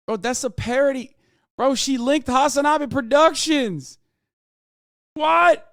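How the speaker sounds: rising disbelief ending in a shouted what; shocked outburst. Real, not acted. Discovers chat link, shocked.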